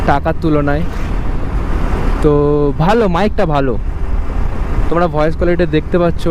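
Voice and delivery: talking over wind noise. Real, not acted. Riding motorbike, talking over engine.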